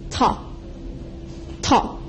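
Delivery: ta soft and very short; neutral tone. Shown as a demonstration. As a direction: drop ta lightly, barely voiced. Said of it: "it's soft and short".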